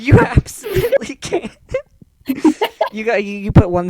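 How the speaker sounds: words bubbling with laughter; cracking up at the player's idea; laughing. Real, not acted. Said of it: "laughing through answer".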